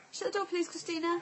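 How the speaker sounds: calling out. Real, not acted. Mum calling her little girl to shut the door. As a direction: call across the room, bright and high.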